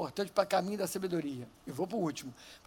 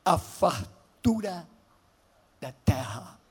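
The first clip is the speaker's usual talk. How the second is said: slow, swelling biblical proclamation; José's invitation read slowly and loudly; dramatic scripture reading; 10 dB louder, much slower than usual. Acted. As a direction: read it like a king's promise.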